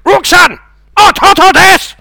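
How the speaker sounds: booming shouted villain in Japanese; high, loud dubbed-film bellow, last line drawn out; Darth Vader. Retold or imitated. Comic imitates friend's Japanese Star Wars impression, villain shouting. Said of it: "his impression of Darth Vader was brilliant".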